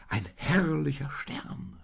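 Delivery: high, slow cry of wonder; drawn-out, high-pitched marvelling; joyful call. A narrator's reading. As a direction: call out in delighted awe. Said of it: "rief er".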